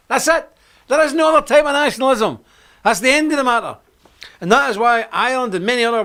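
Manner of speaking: high, loud declaration; preacher at full force; forceful preaching. Real, not acted. Preaching climax on nationalism.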